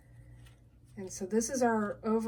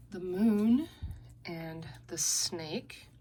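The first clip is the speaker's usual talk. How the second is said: normal talking voice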